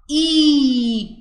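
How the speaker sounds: "إي" held as a long steady "eee"; long ee vowel. Shown as a demonstration. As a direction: stretch "إي" out. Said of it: "معاه مد بالياء هقول عليها". (مدّ بالياء طويل في "إي")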